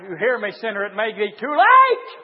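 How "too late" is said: sudden loud, high cry; full-force pulpit shout; shouted warning. Real, not acted. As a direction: hurl the refrain at the congregation. Shouted refrain to sinner.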